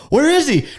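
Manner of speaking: high, loud searching call; confused shout. Retold or imitated. Hunters looking for the goose, confused shout. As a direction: call out lost. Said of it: "they're like".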